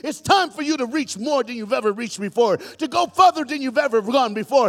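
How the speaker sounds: loud preaching building to a high shout; full-force preaching. Real, not acted. Preacher sending his church family out, full-force preaching.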